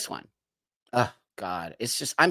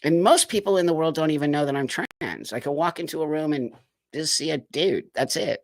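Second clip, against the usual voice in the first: usual speaking voice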